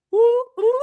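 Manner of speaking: two high, loud woos; excited whooping. Real, not acted. Fan cheering for the wrestler's chances, excited whoops.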